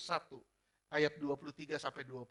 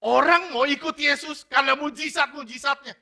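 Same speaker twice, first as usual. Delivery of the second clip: higher, louder sermon declaration; fiery preaching; 10 semitones higher, 15 dB louder than usual. Acted. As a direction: proclaim it over the crowd. Preaching at full force.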